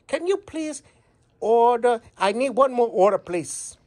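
put-on customer ordering in a thick Chinese accent. Customer asking restaurant about spice, in a Chinese accent. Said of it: "we're gonna do a Chinese accent".

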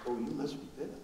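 very soft, trailing pity; hushed pity. Retold or imitated. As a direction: barely breathe the words. Questioners' pitying remark.

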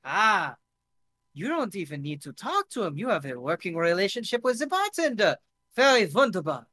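put-on admiring lilt in a German-French accent; hammed-up Franco-German enthusiast. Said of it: "he's switching between German and French".

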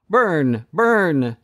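high, mocking repeated jeer; jeering crowd. A narrator's reading. People jeering at the condemned man, high mocking cry. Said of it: "jeer the people".

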